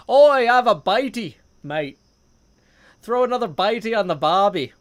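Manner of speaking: loud, high, hammed-up Aussie shout; mock Australian accent. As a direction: holler it like a cartoon Australian. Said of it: "that sounds really Australian".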